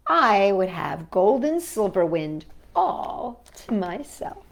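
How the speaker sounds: ordinary delivery, nothing marked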